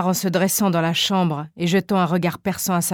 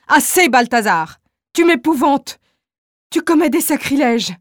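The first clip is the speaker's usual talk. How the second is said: higher, louder, frightened pleading wife; frightened plea; 5 semitones higher, 4 dB louder than usual. Acted. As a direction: cry out to make him stop, scared. Wife begs husband to stop.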